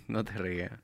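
unvoiced aside, barely audible; whispering. Real, not acted. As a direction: whisper it so only the guest hears.

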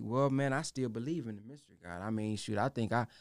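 low, soft, quick mock doubter. Imitating a doubter who waits on a mystery God. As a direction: mumble it like a lazy doubter. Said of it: "For those who say".

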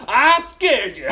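high, loud, drawn-out boast; goofy triumphant yell. Retold or imitated. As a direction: crow it like a drunk prankster. Drunk prankster proclaims his scare.

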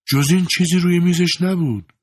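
young woman voiced high and smug. A narrator's reading. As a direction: answer him with mischievous calm. Young woman's cool mocking reply.

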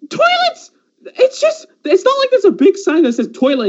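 high, incredulous outburst; exasperated rant. Real, not acted. Ranting about the cousin who couldn't find toilets, high exasperation. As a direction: squeal in disbelief at his excuse.

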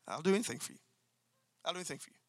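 whisper-close devotion to your wife; tender devotion. Shown as a demonstration. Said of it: "with this attitude".

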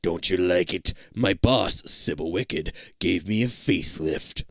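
a hint of a low, creepy driver; lightly voiced villain. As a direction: sneer it sweetly. Monster driver speaks.

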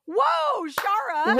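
high, loud, surprised whoa. Real, not acted. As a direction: gasp out her name in amazement. Surprised at teeth count.

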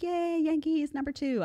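a hint of high, girlish cheering; mock cheer. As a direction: cheer like a casual fan, lightly. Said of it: "I was like".

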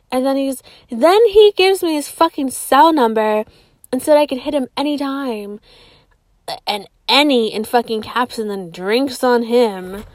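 ordinary talk, nothing marked

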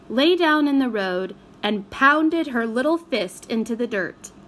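usual speaking voice

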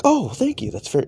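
mock high-pitched thanks from mom; mom's voice. Mom thanking him for picking a movie, high mock voice. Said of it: "And she's like".

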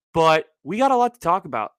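usual speaking voice

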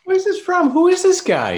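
high, loud excited squeal. Real, not acted. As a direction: squeal it out, thrilled. Excited over hot character.

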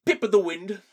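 hammed-up British catchphrase; British accent. Co-host trading British catchphrases in a mock British accent. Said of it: "your Britishness is more of".

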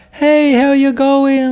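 high, slow, sugary cheerful voice; mock sing-song sweetness, as if nothing is wrong; overly sweet and calm. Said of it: "She expects me to speak like".

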